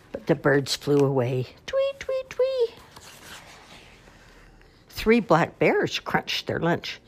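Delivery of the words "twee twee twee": very high tweeting birdsong imitation; bird tweets. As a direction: tweet like little birds flying off. Birds flying away chirping.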